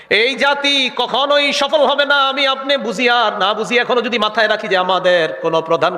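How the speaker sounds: high, ringing sermon delivery; impassioned preaching. Real, not acted. Preacher warning the congregation about their nation, impassioned. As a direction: drive the warning home, high-pitched.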